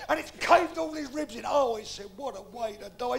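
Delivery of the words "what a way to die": low, soft acted dismay; hushed mock sorrow; subdued condolence. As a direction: sympathise quietly over a death. Listener's condolence in the joke.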